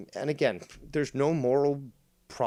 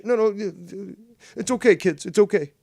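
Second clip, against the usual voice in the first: high, loud mock soothing teacher; hammed-up reassuring teacher, pitched up; 9 semitones higher, 6 dB louder than usual. Teacher calming the class, high and loud mock.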